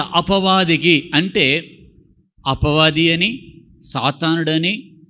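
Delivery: slow emphatic listing, word by word; slow and deliberate. Shown as a demonstration. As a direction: spell it out slowly to the church. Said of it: "సూటిగా చెప్పాడు". (నెమ్మదిగా ఒక్కొక్కటి నొక్కి)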